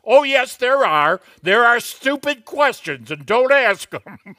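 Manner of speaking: loud, high, emphatic retort of a professor; R C Sproul. Retold or imitated. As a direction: imitate a professor forcefully overruling the class. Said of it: "R C Sproul in a doctoral class one time".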